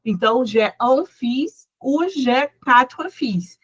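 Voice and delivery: fils as "fis" in j'ai un fils and j'ai quatre fils, slowly; teacher models fils as "fis", slow and even; slow pronunciation demo. Shown as a demonstration. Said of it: "a pronúncia vai continuar igual".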